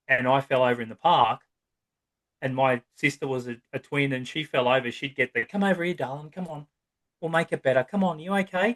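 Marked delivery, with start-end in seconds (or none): 5.5-8.7 lightly voiced coddling parent, higher; a hint of a fussing mum soothing a child; mock soothing parent. Parent comforting the fallen twin sister.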